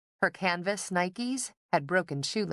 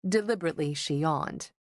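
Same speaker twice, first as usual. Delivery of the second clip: plain reading voice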